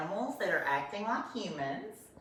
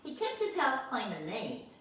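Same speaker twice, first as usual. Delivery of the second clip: ordinary delivery, nothing marked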